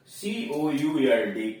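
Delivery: ordinary talk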